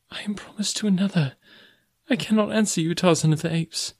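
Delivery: high, hushed, distressed woman's voice; a soft, high cry of refusal; anguished cry. A narrator's reading. Girl with face buried refuses the man she loves, crying softly. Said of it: "she cried".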